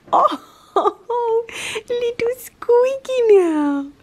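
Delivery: very high squeaky cooing; squeaky pet talk. Real, not acted. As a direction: squeak back at the cat.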